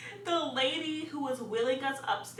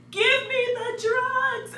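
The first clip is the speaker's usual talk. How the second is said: high, drawn-out yell; retold scream; 14 semitones higher, 5 dB louder, slower than usual. Labouring woman demanding drugs, retold scream. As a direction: loudly plead in a high, stretched scream. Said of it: "I was screaming".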